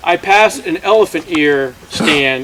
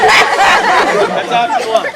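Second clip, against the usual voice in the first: drawn-out high punchline; 11 semitones higher, much slower than usual. Acted. Comic punchline.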